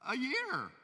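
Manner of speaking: disbelieving king; incredulous protest. Retold or imitated. King protesting to his jailed servant, high and incredulous. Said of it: "he goes".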